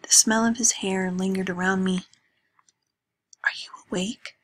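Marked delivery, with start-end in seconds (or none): 3.4-4.4 hushed rising question; night-time whisper to her friend. Acted. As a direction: whisper so you don't wake anyone. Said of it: "I whispered".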